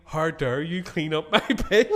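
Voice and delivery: quoted through giggles, slow and loud; laughing quote. Retold or imitated. First telling of his drunk words, laughing. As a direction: laugh while quoting it. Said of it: "she goes to me".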